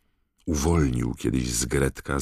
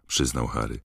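usual speaking voice

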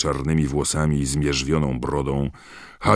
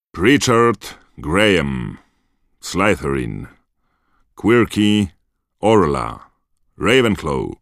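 slow, loud, much higher name call; stern roll call; 4 semitones higher, 6 dB louder, slower than usual. A narrator's reading. McGonagall calling first-years forward one by one, slowly and loudly. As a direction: read each name out to the whole hall.

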